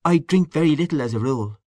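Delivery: mild, unassuming reply; modest. A narrator's reading. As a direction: own up meekly, voice lifted. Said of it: "said little Chandler modestly".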